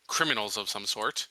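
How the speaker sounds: ordinary talk, nothing marked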